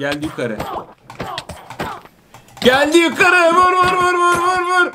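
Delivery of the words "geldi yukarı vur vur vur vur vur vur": high-pitched battle panic; frantic urging. Real, not acted. Gamer as the enemy climbs up, frantic urging. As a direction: urge the blows on frantically.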